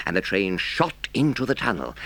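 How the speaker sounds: ordinary delivery, nothing marked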